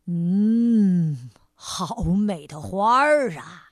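low, slow, admiring tiger; big tiger voice. A narrator's reading. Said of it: "大老虎说". (低沉陶醉的大老虎腔)

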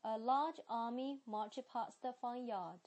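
British accent, careful teacher model; 英式发音示范句. Shown as a demonstration. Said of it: "接下来是sentences".

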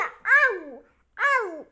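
loud mock gobble, "ah-woo"; playful chomping noise. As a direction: pretend to gobble someone up. Turtle mimics gobbling in story. (夸张地模仿大口吞吃声)

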